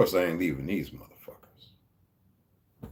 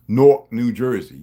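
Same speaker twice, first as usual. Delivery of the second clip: Jersey accent; 9 dB louder than usual. Shown as a demonstration. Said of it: "y'all can hear that".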